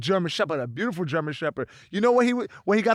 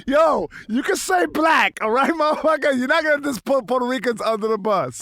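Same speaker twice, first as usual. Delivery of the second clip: higher, louder teasing protest; playful high-pitched scolding; mock outrage; 7 semitones higher, 4 dB louder than usual. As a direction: call them out in mock indignation. Teasing mock outburst at co-host.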